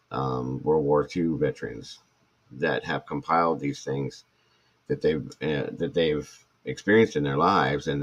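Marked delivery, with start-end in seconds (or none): none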